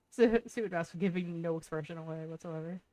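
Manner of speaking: normal talking voice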